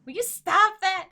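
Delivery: high, very loud, drawn-out protest; loud scolding. Acted. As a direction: scold him loudly, stretching it. Acted scolding of Nick.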